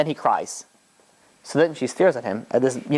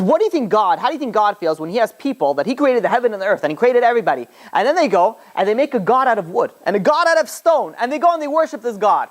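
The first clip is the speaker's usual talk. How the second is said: raised-pitch, insistent storytelling argument; retold impassioned rebuke; 7 semitones higher than usual. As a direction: build the rhetorical question with rising fervour. Rabbi rebuking idol worship in retelling.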